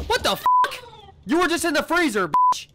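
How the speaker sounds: high, loud disbelieving yell; shocked outrage. Real, not acted. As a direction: caught off guard and furious. Shocked the enemy escaped.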